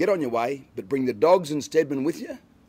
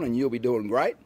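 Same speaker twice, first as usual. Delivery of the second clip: normal talking voice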